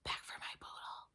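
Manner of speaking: very soft whisper; a girl whispering a secret demand to her friends; quiet whisper. Acted. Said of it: "She whispered real quiet".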